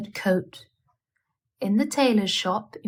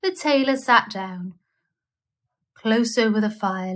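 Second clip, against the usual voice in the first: plain reading voice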